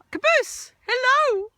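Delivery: very high, loud hello to the dog; loud greeting. Real, not acted. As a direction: call out to the dog.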